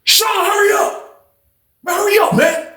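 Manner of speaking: high, slow urgent call up the stairs; calling out high to hurry him; urgent retold call, high-pitched. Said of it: "He was like".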